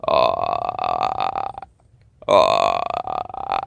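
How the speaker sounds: very low rattling croak; long, low clicking creature call; creature noise imitation. Hunter imitating the noise starting up again, low creature rattle. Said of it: "noise starts up again".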